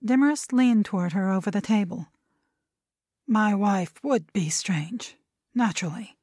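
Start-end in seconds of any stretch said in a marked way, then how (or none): 3.3-6.2 slow, drawn-out words; a slow, deliberate aside; slowly. Acted. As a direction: take your time over each word. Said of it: "he said slowly".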